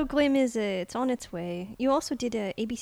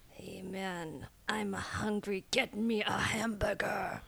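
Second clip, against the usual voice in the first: angry whisper, softer and slow; under-breath pissed; 5 dB quieter, much slower than usual. Shown as a demonstration. Actor mutters the demand, angry and whispered. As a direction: hiss it under your breath, annoyed. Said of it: "under your breath pissed".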